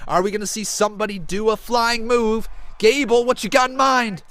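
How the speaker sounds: high, very loud wrestling call; excited shouted play-by-play. Real, not acted. Commentator calling a flying top-rope attack live, excited shouting. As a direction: belt out each move as it lands.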